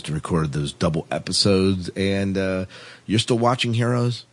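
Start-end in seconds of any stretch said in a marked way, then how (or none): none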